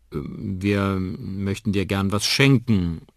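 langsam und zögernd, etwas verlegen. A narrator's reading. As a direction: sag es schüchtern und gehemmt. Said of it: "sagt Onkel Danny verlegen". (Onkel Danny überreicht verlegen ein Geschenk)

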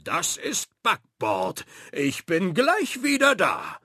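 rising to a high, loud cry; Captain Frank dashing away; eager pirate. A narrator's reading. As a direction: rush off eagerly. Frank announces and rushes off.

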